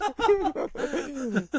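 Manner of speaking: high, hearty laugh. Real, not acted. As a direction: burst out laughing, high-pitched. Laughing hard at the story.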